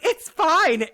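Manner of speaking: mocking quote. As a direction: mimic her brushing it off. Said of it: "she was like".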